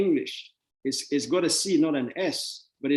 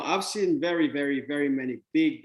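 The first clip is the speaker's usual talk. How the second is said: normal talking voice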